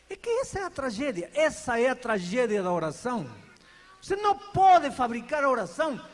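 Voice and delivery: ordinary talk, nothing marked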